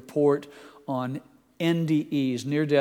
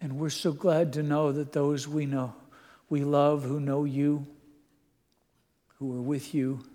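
soft, hushed heartfelt prayer; 5 dB quieter than usual. Acted. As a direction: pray it quietly, from the heart. Closing prayer to Jesus.

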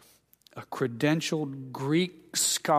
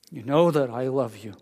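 tearful. Retold or imitated. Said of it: "Can you hear the tear in his voice".

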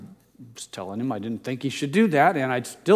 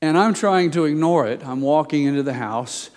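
ordinary talk, nothing marked

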